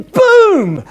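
loud, high dramatic boom. Acted. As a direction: burst it out like a genie appearing. Genie bursts out.

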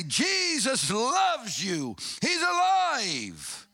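high, slow, ringing declaration; fervent proclamation. Retold or imitated. Preacher hugging strangers on the street, proclaiming joyfully.

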